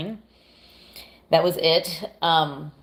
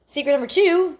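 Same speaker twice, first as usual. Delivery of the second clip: high, bright gushing confession; excited; 9 semitones higher, 5 dB louder than usual. Real, not acted. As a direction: gush about it like a secret. Confessing a guilty pleasure.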